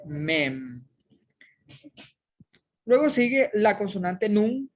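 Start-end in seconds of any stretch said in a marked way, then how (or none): 0.1-0.8 "mem" read out slowly and clearly; sounded-out letter name. Shown as a demonstration. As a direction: read "mem" as the finished word.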